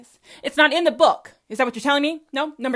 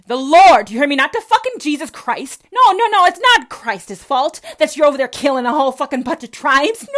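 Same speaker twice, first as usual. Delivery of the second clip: loud, higher ranting shout; angry shout; 4 semitones higher, 11 dB louder than usual. Real, not acted. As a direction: yell it at them, furious. Ranting at Christians about Thanksgiving.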